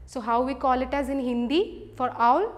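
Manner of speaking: ordinary delivery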